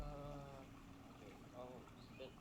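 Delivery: faint voice through a weak mic; almost inaudible, far from the mic; barely audible. Real, not acted. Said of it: "Your mic is really quiet".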